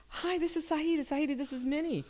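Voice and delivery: high, excited retold greeting; cheery introduction. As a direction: introduce new friends in a high voice.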